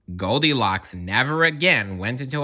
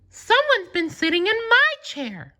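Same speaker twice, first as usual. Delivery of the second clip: very high, put-on Mama Bear voice; a mother bear noticing her bowl, voiced far up. A narrator's reading. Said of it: "she said".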